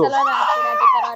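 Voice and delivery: excited call. Real, not acted. Mother urging child to greet.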